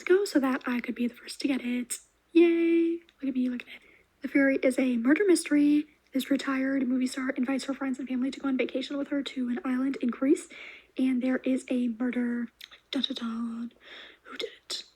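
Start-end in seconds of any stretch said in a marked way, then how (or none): 2.3-3.0 louder, higher little yay; bright self-cheer at getting it first; excited cheer. Real, not acted. 12.9-13.7 whispered dramatic dun dun dun; hushed movie-trailer suspense; mock suspense sting. Mock suspense sting after murder.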